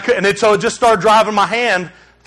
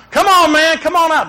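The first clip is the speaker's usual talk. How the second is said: more urgent call; 7 semitones higher than usual. Retold or imitated. Father calling his missing son again, more urgent. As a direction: call again with rising worry.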